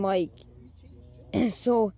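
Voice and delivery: ordinary talk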